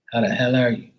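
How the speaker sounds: usual speaking voice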